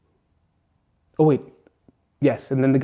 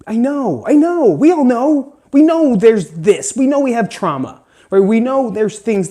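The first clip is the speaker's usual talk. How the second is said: impassioned; 7 semitones higher, 5 dB louder than usual. Real, not acted. Impassioned insistence.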